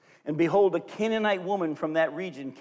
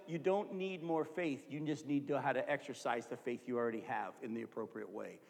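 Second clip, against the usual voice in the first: gentle voice of God; 6 semitones lower, 12 dB quieter than usual. Retold or imitated. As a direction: answer like a patient father, quietly. God's answer voiced softly.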